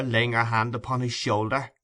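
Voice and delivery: ordinary delivery, nothing marked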